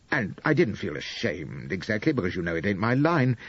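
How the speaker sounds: ordinary delivery, nothing marked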